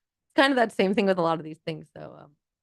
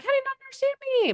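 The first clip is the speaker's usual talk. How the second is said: exasperated; faster than usual. Retold or imitated. Frustrated with being misunderstood.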